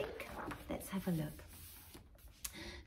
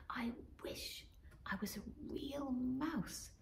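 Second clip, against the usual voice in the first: slow soft longing whisper; wistful whispered wish; 3 semitones higher, 10 dB quieter than usual. Acted. Robin whispers her heartfelt wish. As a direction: wish it quietly, eyes shut. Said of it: "chirped Robin".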